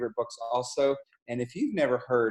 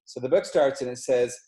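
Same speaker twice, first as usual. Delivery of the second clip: plain reading voice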